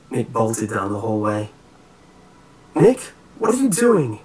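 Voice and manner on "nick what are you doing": high, loud call after a friend; alarmed shout. A narrator's reading. Friend bolts off, called after.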